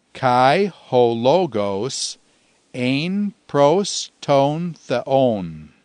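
very slow, evenly spaced Greek words; slow recitation. Shown as a demonstration. Teacher giving students the second Greek line, slowly recited. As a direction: set each word apart for learners. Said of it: "Once again".

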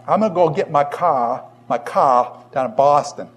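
Boston accent with a dropped R in "car"; broad Boston demo. Shown as a demonstration. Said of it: "Are there dialectical differences in these areas".

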